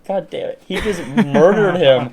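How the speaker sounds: high, slow, hammed-up anguish; mock despair. Anxious kid mourning the elf his brother touched, slow high mock wail. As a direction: wail it like a tiny tragedy. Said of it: "I was like".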